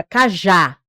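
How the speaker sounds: cajá with a strong final já; stress on last syllable. Shown as a demonstration. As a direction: hit the já of cajá. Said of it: "A última sílaba é mais forte".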